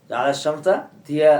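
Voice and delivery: জাআলা শামসা দিয়া, দিয়া with a clear d sound, slow. Shown as a demonstration. Teacher to students, slow recitation demo with দিয়া. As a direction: recite দিয়া with a firm d, not জিয়া. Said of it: "জিয়া না দিয়া?".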